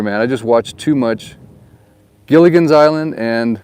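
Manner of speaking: ordinary talk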